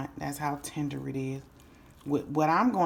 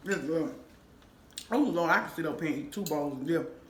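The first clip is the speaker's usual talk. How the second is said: normal talking voice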